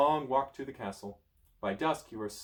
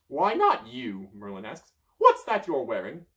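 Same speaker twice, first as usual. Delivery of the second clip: accusing wizard; 3 semitones higher, 8 dB louder than usual. Acted. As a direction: demand an answer. Merlin accusing.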